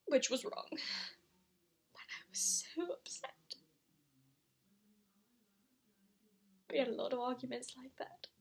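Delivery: soft, drained, sinking almost to a whisper; hushed shame. Acted. Mother ashamed of hitting Christopher's father, hushed and upset. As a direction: admit it quietly, with long pauses.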